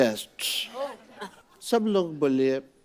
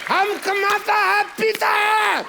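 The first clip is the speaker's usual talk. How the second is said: put-on drunk shouting; 11 semitones higher, slower than usual. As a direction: rant like a sloshed husband. Drunk husband berates maid.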